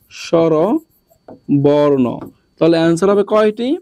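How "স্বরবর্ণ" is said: স্বরবর্ণ stretched very slowly for the class; slow teacher dictation of স্বরবর্ণ; drawn out slowly. Shown as a demonstration. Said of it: "যৌগিক স্বরবর্ণ".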